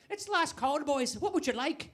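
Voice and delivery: put-on high-pitched old pub landlady; little old Irish barkeeper. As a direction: call last orders as an old Irish barwoman. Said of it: "little old lady who runs the bar".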